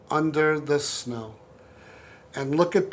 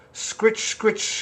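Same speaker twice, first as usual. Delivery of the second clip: scratching sound effect; 5 semitones higher than usual. Retold or imitated. Mimicking paws scratching.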